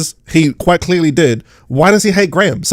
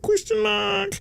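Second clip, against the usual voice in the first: very high and soft, a tiny squeak; very high comic squeak; squeaky question mark; 11 dB quieter, much slower than usual. Pretend. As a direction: squeak it out as a joke. Punchline after rhetorical question.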